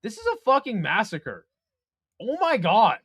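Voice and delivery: usual speaking voice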